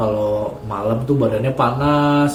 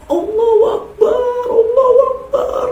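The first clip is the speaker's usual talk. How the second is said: high wailing nightmare cry; terrified scream; 5 dB louder than usual. Retold or imitated. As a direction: scream it out in terror while asleep. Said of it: "sambil teriak".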